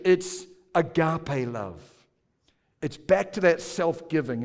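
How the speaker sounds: ordinary talk, nothing marked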